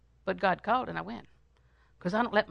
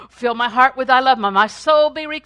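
fervent prayer; 3 semitones higher, 11 dB louder than usual. Acted. Praying aloud in sermon.

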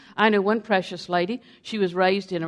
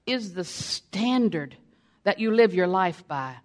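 ordinary talk, nothing marked

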